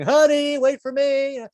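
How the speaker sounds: high, desperate call after a car; calling after her. Acted. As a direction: plead at the departing car. Calling after wife driving off.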